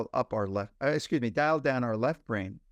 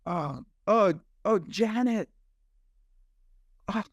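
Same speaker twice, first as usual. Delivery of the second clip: just sigh out comfort to a grieving friend; wordless sympathy; 7 semitones higher than usual. Retold or imitated. Friend comforting grieving Janet without words, sympathetic oh's. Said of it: "you just go".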